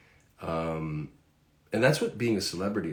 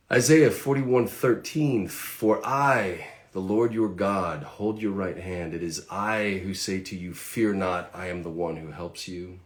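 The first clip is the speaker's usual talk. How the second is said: loud, slow, emphatic Bible reading; scripture reading; 2 semitones higher, 7 dB louder, slower than usual. Acted. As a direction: proclaim the verse slowly to listeners. Reads scripture aloud.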